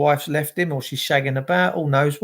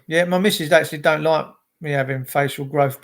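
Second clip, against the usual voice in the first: normal talking voice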